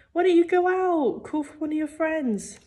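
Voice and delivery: high-pitched, coaxing mother; exasperated parent, pitched well up; nagging mum. A narrator's reading. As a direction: urge him out in a high mum voice, starting loud. Mum nags son to go out.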